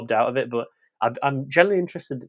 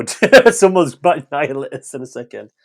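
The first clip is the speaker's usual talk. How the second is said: laughing; 2 semitones higher, 10 dB louder than usual. Real, not acted. Laughs while joking about next film.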